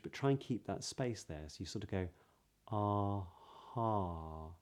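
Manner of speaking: normal talking voice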